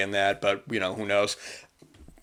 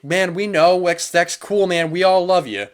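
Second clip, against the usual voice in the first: higher, louder friendly reassurance; a mock supportive friend, lifted and loud; friends reassuring; 7 semitones higher, 4 dB louder than usual. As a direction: gush like a supportive buddy.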